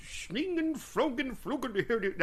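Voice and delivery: high-pitched, nonsense mock German; badly faked German gibberish in a Muppet voice; attempted German accent. Shown as a demonstration. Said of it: "how do you do a German accent?".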